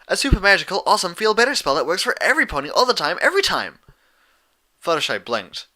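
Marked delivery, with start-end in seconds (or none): 0.1-3.8 high, loud, fast gushing; gushing Pinkie. Acted. Pinkie selling the feel-better spell, fast and giddy. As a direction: rattle it off in a giddy rush. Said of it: "Pinkie explained".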